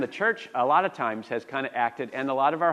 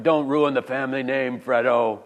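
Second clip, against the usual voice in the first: put-on louder botched mafia accent; Godfather; 7 dB louder than usual. Brother warning Fred about the family name, louder mock Godfather voice. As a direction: push out a hammy mob-boss impression. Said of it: "I'm botching the accent".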